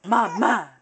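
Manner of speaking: usual speaking voice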